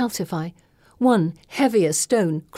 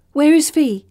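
raised, higher call across the chapel; 9 semitones higher, 8 dB louder than usual. A narrator's reading. Gemma calls out looking for Fee, loud. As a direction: call out for her friend, loud. Narrator voicing Gemma calling out.

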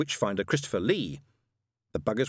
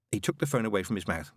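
ordinary delivery, nothing marked

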